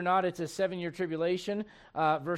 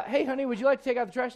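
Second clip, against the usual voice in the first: lightly imitated wife, pitched higher; hinting wife; 6 semitones higher, 4 dB louder, faster than usual. Voices a wife asking indirectly.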